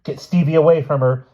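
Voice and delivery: loud, high-pitched command; urgent order. A narrator's reading. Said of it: "Judith ordered quickly".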